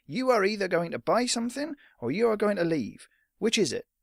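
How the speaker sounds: an ultimatum across the counter; lightly voiced stern shopkeeper. Shopkeeper looms, threatens.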